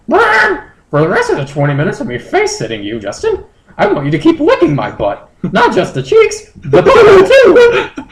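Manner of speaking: very high falsetto, breaking into a laugh; squeaky creature voice, giggling at the end; high Moltres voice. Acted. Moltres instructing Justin, high and giggly.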